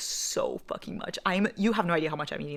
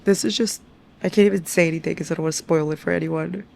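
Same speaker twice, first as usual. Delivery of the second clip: ordinary delivery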